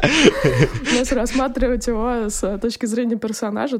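talking through laughter; laughing. Real, not acted. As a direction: still laughing mid-sentence. Laughing after Cartman joke.

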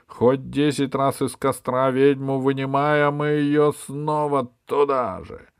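loud, high, pompous inquisitor; zealous bishop. A narrator's reading. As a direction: proclaim like a fanatical bishop. Bishop reports to king. (напыщенный епископ)

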